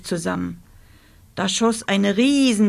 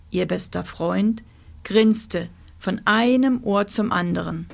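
ordinary delivery

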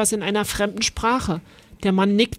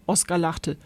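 ordinary delivery

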